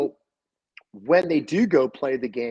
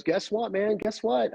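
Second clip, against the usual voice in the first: higher-pitched excited nephew, retold; retold excitement; 8 semitones higher than usual. Nephew phones uncle about his buck, retold excitement. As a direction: imitate someone eager to share news.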